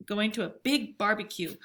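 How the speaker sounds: ordinary delivery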